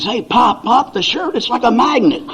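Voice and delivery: put-on high, breathless rush of a teen grandson; breathless excited kid. Grandson runs up breathless to tell his grandfather, excitedly. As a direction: blurt it out like you ran all the way here. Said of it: "He's out of breath".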